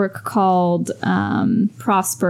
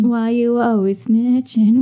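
mock meme voice; 3 semitones higher, slower than usual. Quoting the snitching meme.

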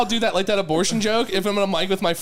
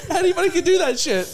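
laughing; 10 semitones higher than usual. Real, not acted. Laughing brag.

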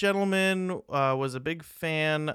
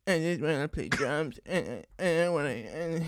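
movie character impression. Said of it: "do him from that nine eleven movie".

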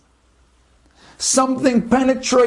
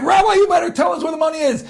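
higher, fast shouted demand; thug's shout; 6 semitones higher, much faster than usual. Acted. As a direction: bark the demand while beating him. Thugs beating the rabbi.

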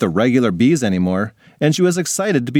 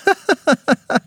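high-pitched ticklish giggle. Acted. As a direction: squeal with tickled laughter. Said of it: "tried tickling her mom".

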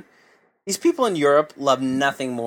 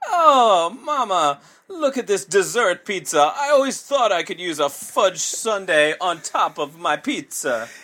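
jolly Italian pizza chef; 5 semitones higher, slower than usual. Pretend. Mock Italian ad continues.

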